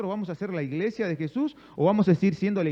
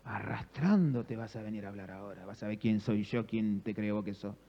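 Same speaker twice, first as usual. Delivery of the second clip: low, softer pretend grudge; mock resentment; 9 semitones lower, 5 dB quieter than usual. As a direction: play the spiteful one savouring payback. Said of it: "no quedé con ese rencor". (rencor fingido, en voz grave y baja)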